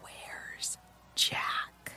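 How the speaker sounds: barely voiced whisper; mouthed words. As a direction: breathe it without voice. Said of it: "mouthed the words".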